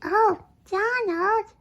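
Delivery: high, slow alarmed cry; alarmed call. Acted. Worried call to Donald.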